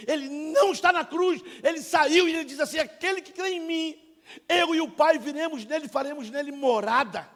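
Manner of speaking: high, loud declaration to the congregation; impassioned preaching. Real, not acted. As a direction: proclaim scripture at full force. Preaching at full force.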